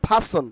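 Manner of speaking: "ফাসন" said PHA-son, no r; careful model "ফাসন", pha then son; corrected pronunciation. Shown as a demonstration. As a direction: say "ফাসন" for repeating, puffed first sound. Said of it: "ফ আকারে ফা".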